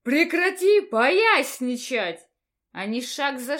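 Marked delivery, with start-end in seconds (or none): 0.0-2.2 angry shout. Acted. Dean advances angrily.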